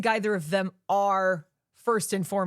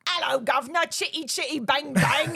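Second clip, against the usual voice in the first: hammed-up bad Cockney, pitched up; mock Cockney accent; 5 semitones higher than usual.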